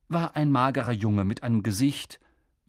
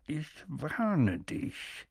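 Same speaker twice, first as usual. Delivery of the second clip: slow, softer threat; menacing uncle; 2 semitones higher, 7 dB quieter, much slower than usual. A narrator's reading. Vernon threatening Harry.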